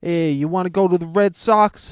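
put-on a loose Boston accent that drifts New York. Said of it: "That's more New York".

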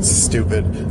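ordinary talk, nothing marked

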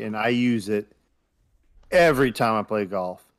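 ordinary talk, nothing marked

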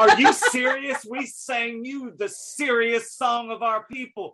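high, slow, loud mock indignation; outraged hooligans. Retelling soccer hooligans rejecting the song, loud drawn-out indignation. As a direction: draw out each word in mock outrage. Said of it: "And they're like".

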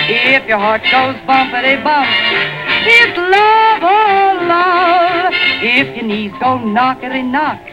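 usual speaking voice

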